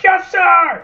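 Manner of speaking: high, drawn-out action-hero yell. Acted. Buff movie soldier answering an order, yelling. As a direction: bellow it at full volume. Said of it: "him being buff and yelling".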